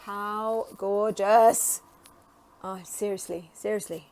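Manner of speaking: ordinary talk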